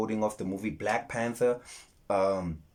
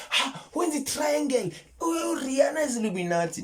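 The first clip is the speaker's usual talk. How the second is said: much higher, silly naive voice; mocking past self; 4 dB louder than usual. Mocking his past belief in Illuminati, high and silly. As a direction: squeak it like a gullible kid. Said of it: "when we used to think".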